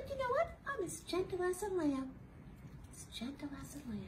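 high, soft and sweet; high gentle voice; gentle lamb voice. Acted.

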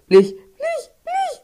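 very high pleading squeal; desperate squeak. Real, not acted. Gamer begging the game in a squeaky plea. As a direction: beg in a squeaky, strained voice.

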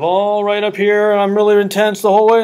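hammed-up full-throttle delivery with no nuance; imitating full throttle. Shown as a demonstration. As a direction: push it loud and intense all the way through. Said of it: "You see what I mean?".

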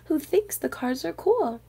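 ordinary delivery

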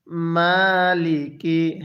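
very slow মালিকি with a long drawn "maa"; correct elongation. Shown as a demonstration. Teacher models the reading for learners, slow elongated মালিকি. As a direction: stretch the first vowel of মালিকি, level and measured. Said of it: "পড়ুন আরেকবার".

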